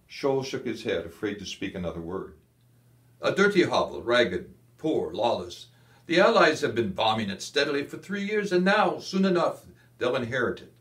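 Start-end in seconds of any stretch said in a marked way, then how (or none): 3.2-10.7 higher, louder scornful officer; contemptuous field marshal. A narrator's reading. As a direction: spit out his contempt for the city. Kesselring scorns Naples.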